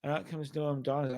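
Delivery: ordinary talk, nothing marked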